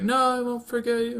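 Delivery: high, hammed-up anime hero; high-pitched mock pleading reply; mock dramatic vow. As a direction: vow it like a melodramatic dreamer. Retelling hero's dream reply.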